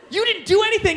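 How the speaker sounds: high, loud mock disbelief; incredulous protest. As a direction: protest in high-pitched bafflement. Bewildered reaction to exploding can, acted.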